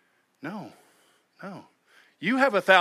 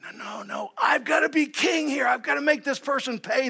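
defiant shout; 11 semitones higher than usual. Retold or imitated. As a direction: yell it like someone who insists on being king. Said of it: "if you ever find yourself in this position saying".

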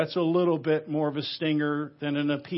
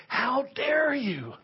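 scandalized, stretching the words high; outraged rebuke; 6 semitones higher, 3 dB quieter than usual. Acted. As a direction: let the built-up steam out in indignation. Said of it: "building steam and building steam".

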